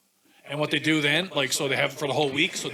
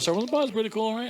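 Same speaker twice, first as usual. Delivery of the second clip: imitating the boys; 8 semitones higher than usual.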